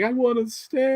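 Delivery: high, imitated wistful insistence; mock plea. Imitating a time traveller who refuses to go home, high mock plea. Voicing film character's plea.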